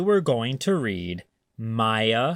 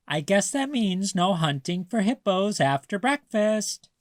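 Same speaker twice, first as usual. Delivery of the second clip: higher, teasing grandma voice; 7 semitones higher than usual. A narrator's reading. Grandma coaxing grumpy Maya, high and teasing. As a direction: sell the silly idea like a sly grandma.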